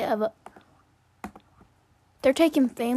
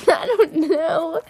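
laughing; 5 semitones higher, 7 dB louder, slower than usual. Real, not acted. Laughing reply.

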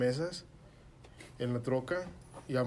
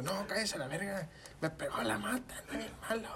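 high, held-back mock yelling, fading softer; grito imitado; 8 semitones higher, 5 dB quieter than usual. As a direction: act out freaked-out yelling without the volume. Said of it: "así le grité como cinco veces". (grito agudo imitado, sin volumen)

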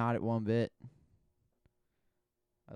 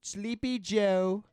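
higher, loud rally-style jabs; Donald Trump; 7 semitones higher, 8 dB louder than usual. Acted. Friend performs Trump mocking rivals, loud and high. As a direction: push each nickname out big and high. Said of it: "we'll do Donald Trump first".

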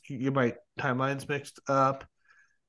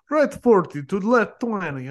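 slow, higher mock Eastern European co-driver accent; 4 semitones higher, 4 dB louder than usual. Retelling a rally co-driver's directions in a light Eastern European accent. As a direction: imitate an Eastern European woman calling pace notes, a little higher and slower. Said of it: "deeper Euro-, Eastern European accent".

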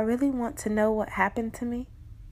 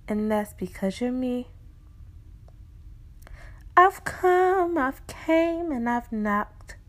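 fervent, slow pleading from the reflection; impassioned spoken word; 5 semitones higher than usual. Acted. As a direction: build it like a pulpit appeal. Reflection preaches with rising force.

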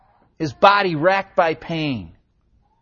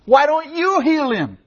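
pleading cry; 10 semitones higher, 4 dB louder than usual. Retold or imitated. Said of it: "And I cry out to Jesus".

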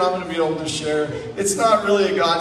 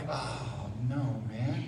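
low, much softer acted groan of words; hushed dismay; 7 semitones lower, 12 dB quieter, much slower than usual. Teller hears his friend has a problem, hushed dismay. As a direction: drop your voice, mock sinking realization.

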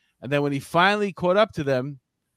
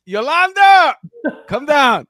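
very high, loud yell to someone upstairs; calling out; 11 semitones higher, 10 dB louder, slower than usual. Acted. Paul yells for his maid to come down, calling out. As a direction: holler the name, stretched out for comic effect.

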